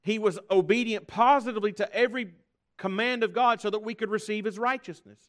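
high, loud pulpit proclamation; full-force preaching. Acted. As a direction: preach it out to the whole church.

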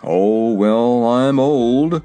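put-on resigned old horse voice; despondent horse. A narrator's reading. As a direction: sigh it out as a weary horse. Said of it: "hanging his head despondently".

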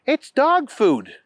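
put-on high, slow little girl answer; drawn-out high child voice.

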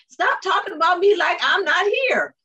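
imitating the uncle's huffy complaint; mock indignant uncle. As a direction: uncle protesting in the car, hammed-up indignation. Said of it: "So everybody was arguing".

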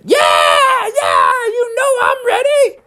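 very high, squealing shouts; acted crowd of eager kids; excited group cheer. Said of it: "And they all said".

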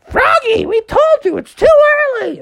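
very high, loud falsetto scolding; scolding parents. Acted. Frog parents woken too early, scolding. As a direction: screech the complaint.

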